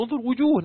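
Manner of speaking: وجوه said high and hit hard; stress on وجوه. Shown as a demonstration. Teacher to class, pointing out وجوه with a high stressed call. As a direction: land hard on وجوه, pitched well up. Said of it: "من الأغراض البلاغية للتنكير".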